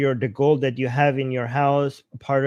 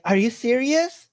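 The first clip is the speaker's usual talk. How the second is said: higher, eager grandma voice; excited grandma; 8 semitones higher than usual. Retold or imitated. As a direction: ask it like a thrilled old grandma. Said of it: "She's like".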